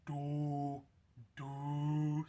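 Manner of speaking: "do" drawn out on one steady note; a long, voiced "do" with no throat noise; deep do sound. Shown as a demonstration. Coach showing the note behind the turkey call, deep do sound. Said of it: "You just want a deep do sound".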